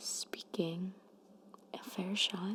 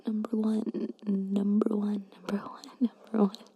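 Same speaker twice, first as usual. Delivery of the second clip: louder soft speaking with more breath; soft spoken voice; 2 semitones higher, 7 dB louder than usual. Shown as a demonstration. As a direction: speak it softly, a bit stronger. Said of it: "when I'm soft speaking".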